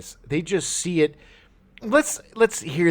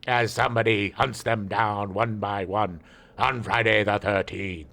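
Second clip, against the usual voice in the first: deep, slow movie trailer announcer; horror trailer voice; 6 semitones lower, slower than usual. Retold or imitated. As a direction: intone it like an old horror trailer. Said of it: "advertisement sounded like".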